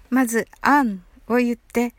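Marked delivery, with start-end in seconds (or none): 0.6-1.0 "an" isolated as the first part of annul; slow, separate model syllable "an"; first syllable alone. Shown as a demonstration. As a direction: sound out "an" on its own for the learner. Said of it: "発音のコツとしては、まず、anを言って".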